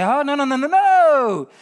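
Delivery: emphatic rejection. Acted. Preacher refusing half belief, loud emphatic no. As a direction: stamp out the idea. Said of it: "you have to say".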